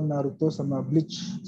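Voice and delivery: normal talking voice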